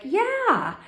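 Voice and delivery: high, drawn-out mock yes; emphatic yes. Italian woman answering about red at a wedding, emphatic retold yes.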